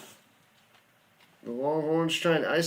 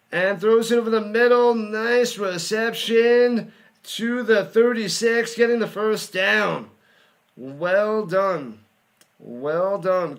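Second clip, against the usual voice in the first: loud, higher play-by-play call, fast then stretched; excited play-by-play; 3 semitones higher, 5 dB louder than usual. Acted. Fan calling the final drive for his stream, loud excited play-by-play. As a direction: shout the catch like a broadcaster.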